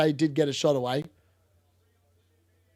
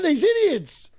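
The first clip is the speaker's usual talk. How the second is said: exasperated; 10 semitones higher, slower than usual. Real, not acted. Frustrated at short corner again.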